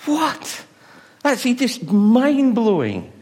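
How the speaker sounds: high, slow astonished exclaim; amazed. Real, not acted. Preacher marvelling at the catch to the congregation, high and astonished. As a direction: let the wonder burst out.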